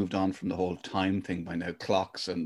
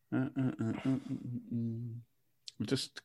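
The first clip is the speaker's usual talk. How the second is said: far from mic; 2 semitones higher, 10 dB quieter than usual. Real, not acted. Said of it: "you're a little bit away from the mic".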